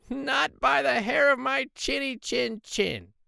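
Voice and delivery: very high, slow squeaky pig voice; squeaky pig refusal. Acted. Third pig defying the wolf, slow high squeaky refusal.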